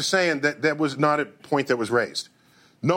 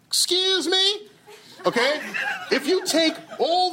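incredulous; 11 semitones higher than usual. Acted. Mock outrage at movie math.

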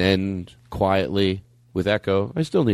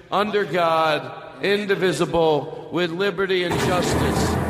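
solemn recitation; 6 semitones higher than usual. Acted. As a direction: recite it like a school pledge. Recites the Pledge of Allegiance.

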